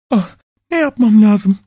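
high, mildly tearful woman's voice; crying wail. A narrator's reading. Wife at a loss over the bleeding key, mildly tearful. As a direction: lightly act a tearful, high-voiced plea. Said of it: "diye ağlamış".

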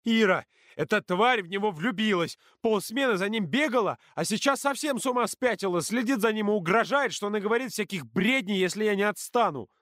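high-pitched angry rant; heated, high-voiced tirade; hysterical accusation. A narrator's reading. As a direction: pour out the complaint in outrage.

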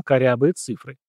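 ordinary delivery, nothing marked

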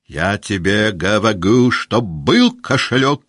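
loud, high, slowed-down yelling; angry shout. Acted. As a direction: shake him and shout every word. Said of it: "кричал Денисов".

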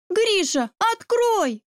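high-pitched urgent call; crying out. A narrator's reading. Sister at the locked door to her brother, high urgent cry. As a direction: shout the demand, pitch leaping up. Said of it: "утонул в криках Яны".

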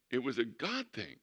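ordinary delivery

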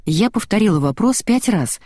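ordinary delivery

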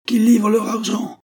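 high, loud mob roar. A narrator's reading. The mob roars back at the priest, high and loud. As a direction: bellow as one crowd. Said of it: "vociféra la foule d'une seule voix".

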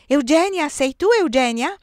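calling out loud and high into the dark; impatient call. A narrator's reading. Giulia calling her sister at the gate, impatient. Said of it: "e poi con impazienza".

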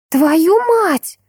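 high-pitched exclamation without extra volume; shocked cry. A narrator's reading. Friend reacting to a secret romance, crying out. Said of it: "вскрикнула подруга".

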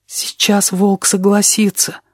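slow, dread-filled inner voice; horrified thought. A narrator's reading. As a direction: think it slowly in dread. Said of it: "с ужасом подумал кузнечик".

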